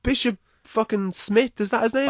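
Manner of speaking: high mock priestly stage voice; priest's stage voice. Podcaster joking about bishops, high stage voice. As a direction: lightly put on a priest's stage voice.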